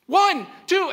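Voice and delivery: slow, loud, high stern dad count; dad voice shout. Retold or imitated. Father warns his son with scissors in a stern shout. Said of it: "I'm using my dad voice".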